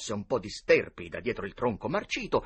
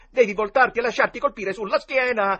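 insistenza; 12 semitones higher, 10 dB louder than usual. Acted. Tom insists, book rules.

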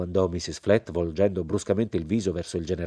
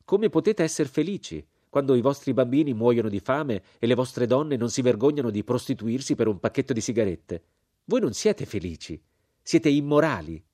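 higher-pitched reproach at the peaks; indignant American woman; 5 semitones higher, 4 dB louder than usual. A narrator's reading. Mrs Flett reproaching the narrator over hungry children, indignant. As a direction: scold him in disbelief.

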